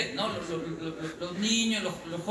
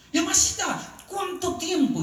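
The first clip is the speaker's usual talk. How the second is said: much higher, louder delighted reunion call; mock joyful greeting; 10 semitones higher, 4 dB louder than usual. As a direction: greet him like a long-lost friend. Greeting a stranger as old friend.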